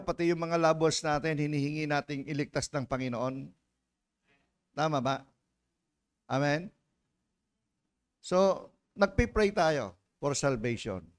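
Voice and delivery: ordinary talk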